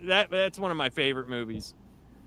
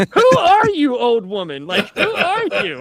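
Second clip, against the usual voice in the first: very high, loud "Who are you" through laughter; a hammed-up shriek at the brash old woman; mock yell; 12 semitones higher, 11 dB louder than usual. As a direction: shriek it at her in mock outrage.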